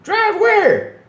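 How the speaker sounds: very high, loud, disbelieving question; as if the idea is absurd; incredulous. Real, not acted. Mocks the Dominican setting.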